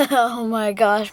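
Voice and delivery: ordinary delivery, nothing marked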